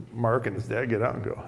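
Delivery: plain reading voice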